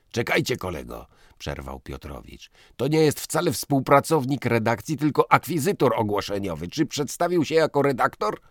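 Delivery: loud, high, brash editor voice; loud blustering editor. Acted. Piotrowicz explains to his old classmate, loud and blustering. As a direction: boom it out like a quarrelsome boss.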